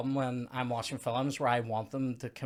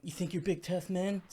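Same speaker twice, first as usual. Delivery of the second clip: Rose showing off; 6 semitones higher, 4 dB quieter than usual. Retold or imitated. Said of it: "she's doing the whole".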